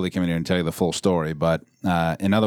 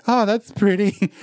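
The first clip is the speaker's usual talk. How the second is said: dreamy naive gushing; 12 semitones higher, 3 dB louder, slower than usual. Retold or imitated. Mocking naive alien fan.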